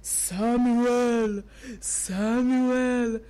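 slow, drawn-out low call; God's calling voice. Acted. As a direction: call the sleeper with long, even syllables. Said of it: "Then God called again".

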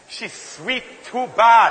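very slow, savoring "she's sweet too bad"; drawn-out admiring. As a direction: stretch each word as if admiring her. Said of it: "look at a pretty lady and say".

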